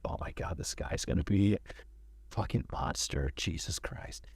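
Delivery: whispered nervous thought, retold; nervous aside. Director privately dreading meeting the drummer, nervous whisper. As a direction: mutter it under your breath, dreading the meeting.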